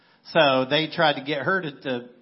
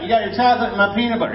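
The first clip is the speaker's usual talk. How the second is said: higher, louder and fast ad line; quoting the commercial; 6 semitones higher, 8 dB louder, much faster than usual. Retold or imitated. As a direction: rattle it off like a seventies TV spot. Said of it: "this phrase became huge".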